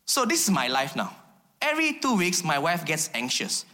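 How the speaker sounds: ordinary talk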